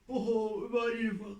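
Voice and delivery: usual speaking voice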